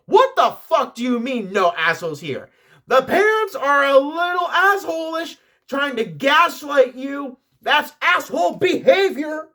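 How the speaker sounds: high, loud mock outrage; getting heated. Host reacting to gaslighting parents, theatrically heated. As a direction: rant indignantly, climbing in pitch. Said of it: "I'm getting heated".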